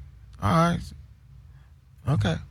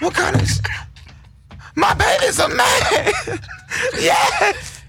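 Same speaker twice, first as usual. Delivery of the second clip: shrieking mother; much slower than usual. Retold or imitated. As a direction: scream with maternal overjoy. Mother shrieking about her son.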